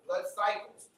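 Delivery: ordinary talk, nothing marked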